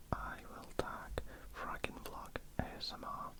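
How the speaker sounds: ordinary delivery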